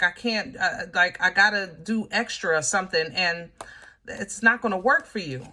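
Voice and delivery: usual speaking voice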